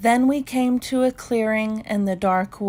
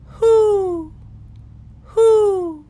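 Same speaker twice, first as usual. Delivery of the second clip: high, loud, drawn-out owl hoots; owl call; 12 semitones higher, 7 dB louder, much slower than usual. A narrator's reading. Pa calling out to the owl in the clearing, loud high hoots. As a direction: hoot up into the trees like a horned owl. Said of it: "And then Pa called".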